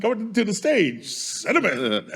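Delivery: mock stage announcer, starting high and drawing out the name; strip club announcer. Podcast host jokingly introduces a dancer to the club, announcer voice. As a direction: announce the dancer with a long, slow flourish.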